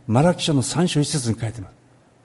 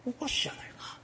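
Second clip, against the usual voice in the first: whisper; 9 semitones higher, 13 dB quieter, faster than usual. Retold or imitated. Voicing the crowd's suspicion.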